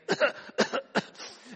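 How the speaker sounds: breathy put-on cough, laughing; a feeble cough to sell it; fake cough. Worker faking illness to the boss, mock cough. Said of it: "you've got that sick voice".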